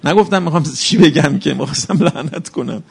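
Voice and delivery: ordinary delivery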